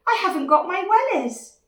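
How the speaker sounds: loud, high wail; panicking little dinosaur. Acted. Dinosaur without his wellies, loud panicky wail. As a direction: cry it out in mock panic.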